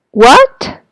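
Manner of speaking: what with a sharp upward tune; what sliding high like a second tone; rising what. Shown as a demonstration. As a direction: ask what as a rising question. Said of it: "if you're going up".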